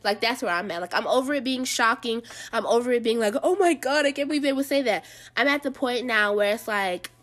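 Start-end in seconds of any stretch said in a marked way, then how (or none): 3.4-5.0 higher-pitched scandalized quote; exaggerated disbelief, pitched up; mock shock. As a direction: imitate a shocked onlooker. Mimics shocked reaction she's over.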